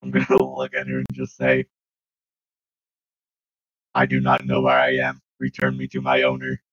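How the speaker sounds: deep, loud mechanical monotone; robot voice. Acted. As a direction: speak like a lost service robot. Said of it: "pretend to be a, a non-sentient, sentient robot".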